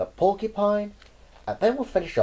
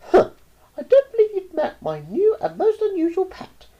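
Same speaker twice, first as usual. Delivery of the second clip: put-on much higher, cheeky boy; 7 dB louder than usual. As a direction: brag like a cocky kid. Roy speaking.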